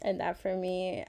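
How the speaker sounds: usual speaking voice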